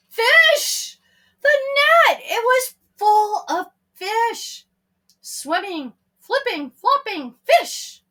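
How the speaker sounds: excited. A narrator's reading. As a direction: burst out with wonder. Narrator's climax, fish appear.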